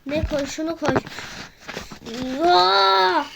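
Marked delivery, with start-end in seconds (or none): 2.1-3.2 loud startled yell; a sudden yell of surprise; surprised yell. Real, not acted. Gamer yelling at a sudden surprise.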